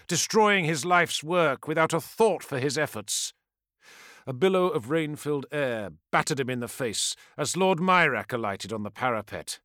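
plain reading voice

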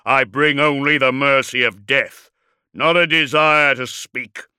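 loud, thunderous war-machine declaration; roaring. A narrator's reading. Dreadnought promises his enemy only death, roaring. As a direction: roar it with heavy force. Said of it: "roared Magnoric".

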